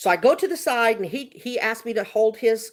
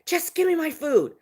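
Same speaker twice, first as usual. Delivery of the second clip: higher, mock irritated demand; 7 semitones higher than usual. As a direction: imitate an irritated passenger. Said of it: "Y'all, he got irritated".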